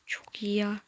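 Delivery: soft unvoiced whisper. Real, not acted. Streamer keeping voice down, whispering. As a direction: whisper it so no one in the house hears. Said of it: "Sesim az çıkıyorsa çok özür dilerim".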